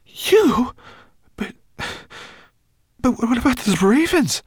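high, loud disbelieving stammer; stunned stammer. Acted. Ben to Twigleg, high stunned stammer. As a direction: Ben can't believe his friend betrayed him. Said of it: "stammered Ben".

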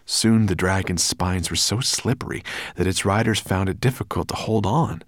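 ordinary delivery

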